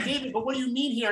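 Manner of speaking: high, quick, puzzled editor voice. Retold or imitated. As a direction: play the confused editor asking. Imitating his editor's question.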